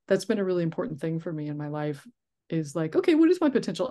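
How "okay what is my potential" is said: eager self-talk. Retold or imitated. Excited retold self-quote.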